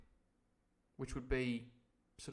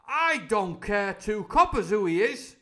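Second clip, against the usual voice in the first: loud, raised gruff shout; crying out; 8 semitones higher, 12 dB louder than usual. Acted. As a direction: bellow it over the room. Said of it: "cried Silver".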